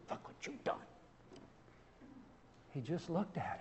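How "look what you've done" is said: acted accusing whisper; whispered reproach. Preacher acting out a mean-mugging Jesus scolding Peter, whispered reproach. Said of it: "mean mugged Peter".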